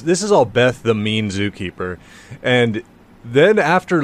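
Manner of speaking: ordinary talk, nothing marked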